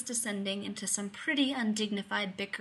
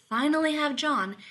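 ordinary delivery

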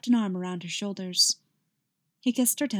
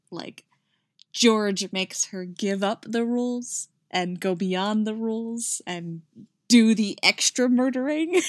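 usual speaking voice

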